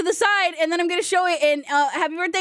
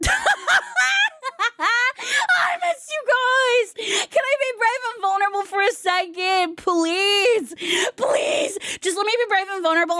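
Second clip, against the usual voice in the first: mock-tearful plea; 5 semitones higher than usual. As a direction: beg like a fake crybaby.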